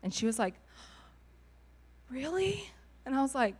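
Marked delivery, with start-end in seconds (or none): 2.1-2.7 surprised. Retold or imitated. Girl disbelieving she is adored.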